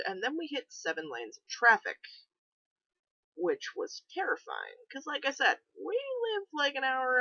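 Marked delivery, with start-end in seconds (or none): none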